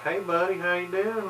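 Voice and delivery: high-pitched imitation Kentucky greeting; Kentucky twang. Locals greeting him in Williamsburg, high Kentucky twang. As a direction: sing out a twangy Kentucky hello. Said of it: "a little bit of twang".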